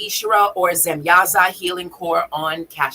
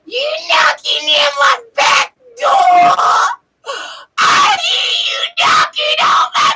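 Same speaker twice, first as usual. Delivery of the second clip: loud, very high cartoon wail; Lois; 5 dB louder than usual. Cartoon wife high as can be on the couch, loud wail. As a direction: belt it out in a screechy put-on voice. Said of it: "and she was like".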